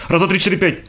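very fast, high-pitched counting; machine-gun count. A narrator's reading. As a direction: rattle off the numbers, almost shouting. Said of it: "считает с пулемётной скоростью". (скороговоркой, почти крича)

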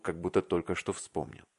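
usual speaking voice

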